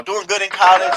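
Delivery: amused chuckling repeat; laughing. Real, not acted. Vlogger mocking the student's image, laughing. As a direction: laugh through the words.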